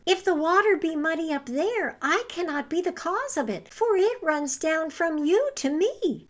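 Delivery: lamb voice. A narrator's reading. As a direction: answer timidly like the lamb. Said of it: "said the lamb".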